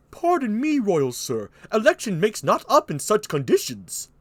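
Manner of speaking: high, put-on courtly voice; Burgundy. Burgundy declining the king's offer, high courtly voice.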